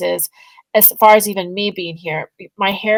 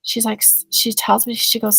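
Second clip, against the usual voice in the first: normal talking voice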